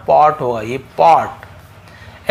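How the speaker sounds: "पॉट" with an open aw, last one drawn out; teacher confirming "पॉट" with rounded aw; right pronunciation. Shown as a demonstration. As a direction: stretch the aw in "पॉट", slowly. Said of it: "जी हाँ".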